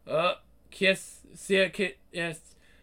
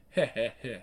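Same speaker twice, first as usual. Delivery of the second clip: menacing chuckle; 6 semitones lower, 3 dB quieter than usual. Acted. Villain mocking a cornered enemy.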